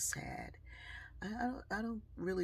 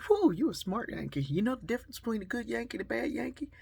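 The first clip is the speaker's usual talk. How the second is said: loud, fast, higher ribbing; teasing stranger; 7 semitones higher, 6 dB louder, faster than usual. Retold or imitated. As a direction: needle the Yankee with a grin. Man teasing a Yankee.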